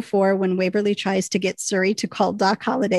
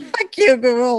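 mock outburst at Google; 3 semitones higher than usual. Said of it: "We're like".

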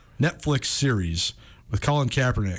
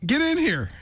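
pitched-up call across the room; 10 semitones higher than usual. Real, not acted. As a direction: call him in from the doorway. Calling guest into studio.

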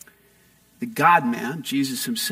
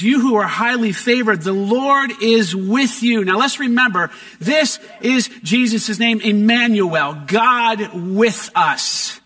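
raised, emphatic sermon reading; preaching; 4 semitones higher, 4 dB louder than usual. Acted. Pastor preaching the Annunciation to the congregation, emphatic. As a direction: proclaim the scripture with fervor.

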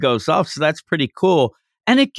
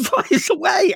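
ordinary talk, nothing marked

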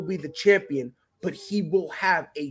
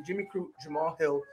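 ordinary talk

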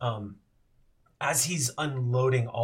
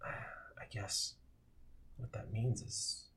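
soft, fading ghost; 3 semitones lower, 12 dB quieter than usual. Acted. Darren, fading ghost, speaks softly.

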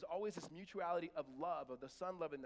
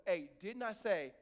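stern parental warning, louder and drawn out; 2 semitones higher, 7 dB louder, slower than usual. Acted. Parent warning kids it's time to go, stern. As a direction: warn the kids like you mean it. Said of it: "there's that voice".

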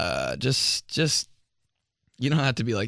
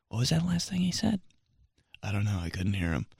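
much softer, lower mumble; quiet mumble; 6 semitones lower, 7 dB quieter, faster than usual. Retold or imitated. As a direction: mutter it away from the mic. Staffer unable to hear.

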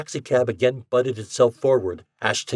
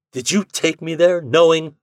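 accusing; 7 semitones higher, 6 dB louder than usual. A narrator's reading. Ashton accusing.

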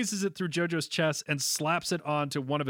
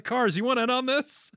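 mild mock yell; 6 semitones higher than usual. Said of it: "I just love him yelling".

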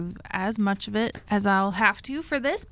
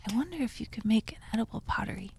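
whispered aside; 6 dB quieter, much faster than usual. Real, not acted. Musing aside to herself.

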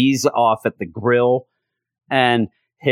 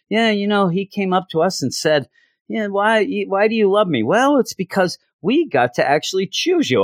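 higher, sweetly storytelling dad voice; sentimental stepfather; 10 semitones higher than usual. Retold or imitated. Stepfather telling guests Owen's adoption story, high fond voice. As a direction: tell the adoption tale as a fond old dad.

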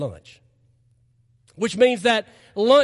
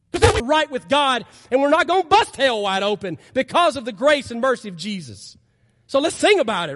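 louder, higher preaching at full force; fervent preaching; 4 semitones higher, 5 dB louder than usual. Real, not acted. Pastor to congregation, calling for the band, in fervent louder preaching. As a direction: lift the room with rising preaching.

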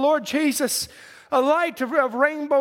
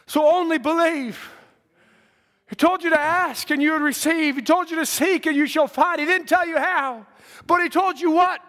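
raised, insistent pulpit exhortation; fervent preaching; 4 semitones higher than usual. Real, not acted. Preacher urging the church to believe tonight, fervent preaching. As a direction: drive each phrase at the congregation, building in force.

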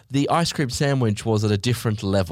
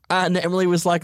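ordinary talk, nothing marked

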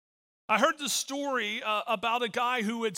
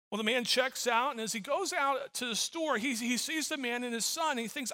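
usual speaking voice

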